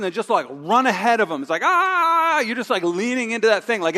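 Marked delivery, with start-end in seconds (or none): 1.6-2.4 high, loud effortful groan; straining groan. Retold or imitated. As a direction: push with all your might. Rushing ahead of Jesus.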